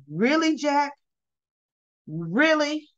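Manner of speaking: two separate, pointed questions with long pauses; Nikki, incredulous. Retold or imitated. Nikki to Jack over his concern, mildly incredulous.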